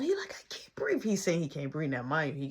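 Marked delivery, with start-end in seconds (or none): 0.3-1.0 soft, voiceless strained whisper; mock breathless whisper; stifled gasp. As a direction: squeeze it out as if smothered. Imitates boy held down, muffled.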